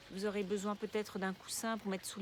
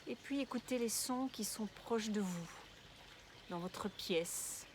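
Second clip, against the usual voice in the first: guided meditation; 6 dB quieter than usual. Real, not acted. Guide une relaxation de yoga nidra. (voix douce et posée de méditation guidée)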